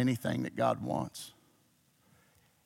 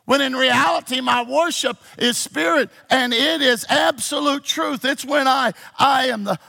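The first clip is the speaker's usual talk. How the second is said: high, loud sermon cry; full-force preaching; 10 semitones higher, 7 dB louder than usual. Real, not acted. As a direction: preach it loud, building to a long held shout.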